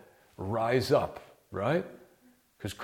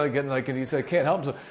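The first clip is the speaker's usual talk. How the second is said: normal talking voice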